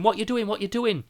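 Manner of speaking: put-on a frightened girl asking; scared child. Granddaughter frightened in car.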